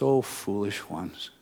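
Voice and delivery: slow, soft and tender "Oh, foolish ones"; gentle, not harsh. Retold or imitated. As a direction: chide them kindly, as you would a child. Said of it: "I don't think he said that harshly".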